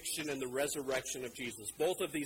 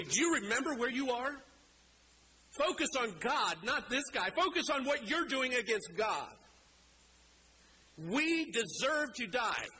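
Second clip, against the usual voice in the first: higher-pitched, earnest rebuke, only mildly raised volume; acted yell back; 7 semitones higher than usual. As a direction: call across to set him straight. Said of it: "he yells back at him".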